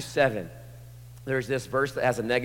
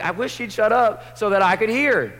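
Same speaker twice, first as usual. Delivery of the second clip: mock annoyed churchgoer; 10 semitones higher, 8 dB louder than usual. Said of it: "you're like".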